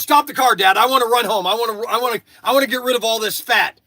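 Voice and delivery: loud, high urgent demand; imitating son yelling stop the car. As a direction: shout it from the back seat. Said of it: "He goes".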